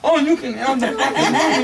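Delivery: high, loud, angry retort; furious. Acted. Departing guest yelling at man behind him, furious. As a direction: fling the room at him in a yell. Raging guest gives up room.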